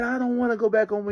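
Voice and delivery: put-on high, loud child's refusal; a loud, high whiny refusal; defiant boy. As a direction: play the kid refusing to go home. Boy refusing in front of police.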